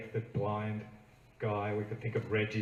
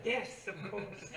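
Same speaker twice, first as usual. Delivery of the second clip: mock obvious; 10 semitones higher, 7 dB quieter, much slower than usual. Friends answer with mock obviousness.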